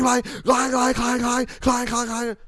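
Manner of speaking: high, loud clanging of cart wheels, over and over. Retold or imitated. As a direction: let the whole store clang. Imitating whole store clanging.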